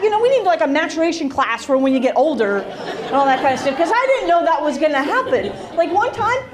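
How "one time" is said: usual speaking voice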